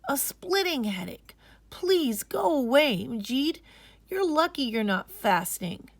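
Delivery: a tired sister pushing him off; weary schoolgirl. Acted. Sister telling her brother to leave her alone. Aisha sends Majid away.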